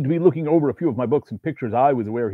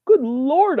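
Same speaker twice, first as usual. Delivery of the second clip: high, louder exclamation; shocked cry; 13 semitones higher, 6 dB louder than usual. A narrator's reading. Writer shocked by the demon, high loud cry. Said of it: "I cried".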